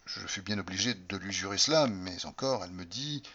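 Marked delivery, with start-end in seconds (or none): none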